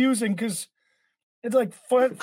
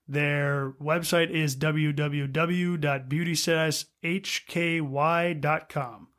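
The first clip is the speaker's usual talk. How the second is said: ad read; 4 semitones lower, 4 dB quieter than usual. Acted. Sponsor ad read.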